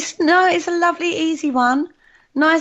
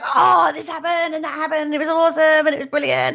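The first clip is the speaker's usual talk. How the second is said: mock gushing; 8 semitones higher than usual. Co-host teasing hosts about their investigation, mock gushing. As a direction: imitate them raving about their trip. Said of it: "Whereas you guys".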